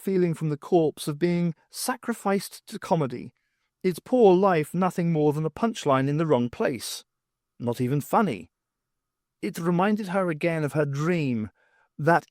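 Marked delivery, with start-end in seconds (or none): none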